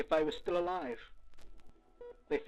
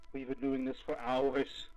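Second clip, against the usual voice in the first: ordinary talk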